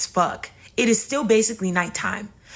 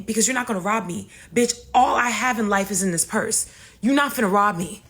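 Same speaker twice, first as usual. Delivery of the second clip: ordinary talk, nothing marked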